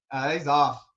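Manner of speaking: ordinary talk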